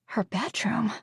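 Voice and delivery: whispered secret. Acted. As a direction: hush it like a secret. Telling a secret location.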